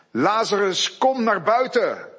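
calling out with a loud voice; loud commanding call. Acted. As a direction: call the dead man out of the tomb. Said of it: "riep hij met luide stem".

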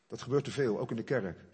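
usual speaking voice

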